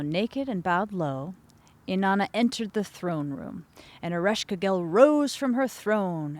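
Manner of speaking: ordinary delivery, nothing marked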